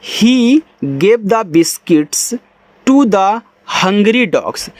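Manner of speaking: English sentence read word by word, very slowly. Shown as a demonstration. Teacher reads the sentence slowly for pupils, very slow. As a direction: read each word slowly and separately, like dictation. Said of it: "अब ध्यान से देखो".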